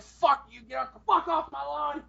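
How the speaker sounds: slow, loud get-off-my-lawn geezer; yelling old man. Pretend. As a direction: chase the kids away in mock fury. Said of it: "I would yell at everybody".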